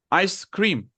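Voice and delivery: ice, then cream, as two words; ice cream separated. Shown as a demonstration. Teacher showing how some people write it, ice cream separated. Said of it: "they will separate it".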